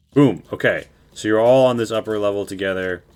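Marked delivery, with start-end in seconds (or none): 0.1-0.4 triumphant boom. Real, not acted. Finishes the build triumphantly.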